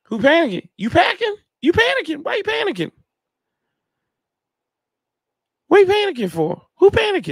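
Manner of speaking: lightly hammed-up high "who panicking"; mock high voice. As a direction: tease them in a high sing-song. Mocking fans who are panicking.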